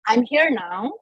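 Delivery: usual speaking voice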